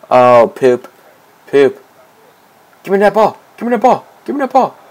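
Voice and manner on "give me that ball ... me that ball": high-pitched, insistent calls for the ball; urgent demand. Real, not acted. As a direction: demand it three times, pitched way up.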